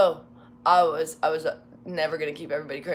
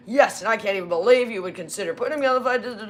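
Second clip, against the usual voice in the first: mock indignant; 4 semitones higher, 5 dB louder, faster than usual. Supervisor continues his outburst.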